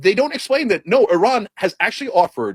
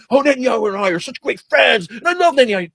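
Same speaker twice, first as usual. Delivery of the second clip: higher gushing Trump voice; mock Trump; 5 semitones higher than usual. Mocking Trump praising Netanyahu, higher and gushing. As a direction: gush about a best friend. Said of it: "he keeps saying over and over again, Trump".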